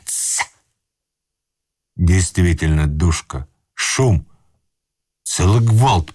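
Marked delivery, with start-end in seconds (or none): 0.1-0.4 soft sharp shh to silence; a quick hiss for quiet; hushing sound. A narrator's reading. Hushing under the bed.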